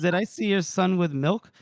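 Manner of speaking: a hint of a high little voice; Gorst's high little voice. Big man asking farmer for milk in a lightly squeaky voice. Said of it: "strange high little voice".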